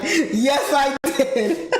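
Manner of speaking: high, laughing reply; retold triumph, giggling; gleeful confirmation. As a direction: crow it through a laugh. Gleeful answer to astonished mother, laughing.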